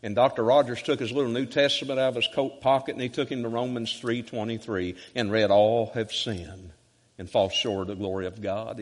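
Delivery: ordinary talk, nothing marked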